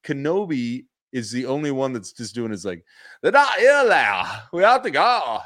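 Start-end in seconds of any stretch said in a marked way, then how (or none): 3.2-5.4 put-on high, loud Valley Girl voice; mock English Valley Girl. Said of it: "You sound a little Valley Girl".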